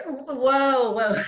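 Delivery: stumbling in the dance, surprised; startled whoa. Acted. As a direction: caught off balance mid-step.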